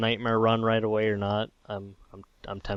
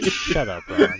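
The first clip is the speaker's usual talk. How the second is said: mock outrage; 4 dB louder, slower than usual.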